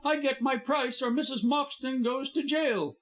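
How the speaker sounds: high, hard ultimatum; furious high ultimatum. A narrator's reading. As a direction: spit out the demand. Furious blackmailer.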